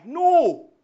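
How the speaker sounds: loud, high emphatic No. Real, not acted. Preacher rejects what the sailors did not say, loud. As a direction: cut off the wrong idea with a shout.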